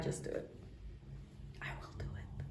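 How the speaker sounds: breathy whisper. Acted. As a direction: whisper it like a secret. Whispered resolve after laugh.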